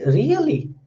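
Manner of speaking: surprised "really" with a big swoop in pitch; surprise. Shown as a demonstration. Teacher showing how pitch adds meaning, surprised "really". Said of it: "so there is a surprise in it".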